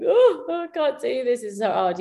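high-pitched mock wail, lightly done; mildly hammed-up weepy lament; retold crying. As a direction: imitate a tearful moan without really crying. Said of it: "crying, going".

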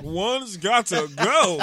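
slow, drawn-out host voice, laughing; mock game show host. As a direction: drag it out like a TV host, cracking up. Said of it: "Your game show host voice kills me".

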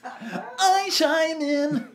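high, slow, cartoonish squeal; high silly voice. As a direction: stretch it out in a squeaky voice. Comic voice stinger, podcast bit.

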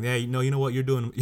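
loud heated retort; angry comeback. Retold or imitated. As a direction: start a furious put-down. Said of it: "I'm like".

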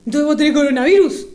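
loud, fast imitated accusation; mock accusation. Sister imitating her brother blaming her, loud and rushed. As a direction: blurt it out loudly, pointing blame.

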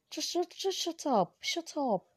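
high-pitched mock scold; squeaky telling-off. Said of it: "But you know me, say".

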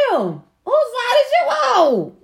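high, drawn-out mock outrage; indignant disbelief. Retelling her reply to her mama, indignant disbelief. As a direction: stretch it out in high-pitched disbelief.

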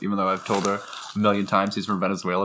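usual speaking voice

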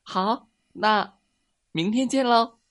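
very high, small happy voice; happy mouse goodbye. A narrator's reading. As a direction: gleefully call goodbye to friends. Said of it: "小老鼠高兴地挥了挥手". (小老鼠高兴地尖声道别)

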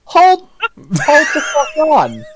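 slow, drawn-out outraged shout; mock outrage. Real, not acted. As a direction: hold it, in mock disbelief. Shocked at being called old.

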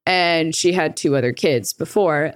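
ordinary delivery

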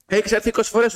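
high-pitched retold complaint; acting out a fed-up customer; exasperated scolding. Customer scolding a returning peddler, exasperated.

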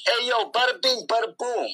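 high hammed-up New York mobster; mobster accent. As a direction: ham up a wise-guy bit. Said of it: "I can just go".

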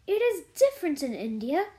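quick, mildly disdainful retort. A narrator's reading. Mary telling Martha India is different, mildly disdainful. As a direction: answer with a touch of disdain.

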